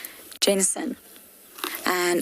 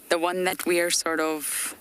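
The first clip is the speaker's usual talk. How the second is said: ordinary delivery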